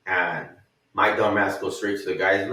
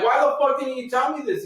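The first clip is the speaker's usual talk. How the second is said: much higher indignant demand; mock outrage; 13 semitones higher than usual. The narrator confronts his supervisor, high indignant. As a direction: confront him in disbelief. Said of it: "and then I'm like".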